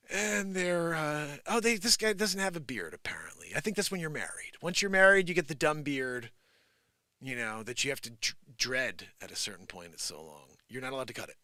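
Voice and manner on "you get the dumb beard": ordinary talk, nothing marked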